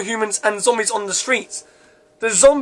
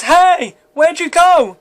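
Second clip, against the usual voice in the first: high-pitched narrated shout; 7 semitones higher, 4 dB louder, much slower than usual. A narrator's reading. Elliot to friends from the road, shouting. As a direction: call out as if across a road. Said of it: "he shouts".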